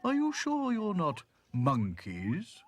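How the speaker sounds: slow, high, loud questioning; suspicious giraffe. A narrator's reading. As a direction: draw out the doubt. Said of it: "eyed them suspiciously".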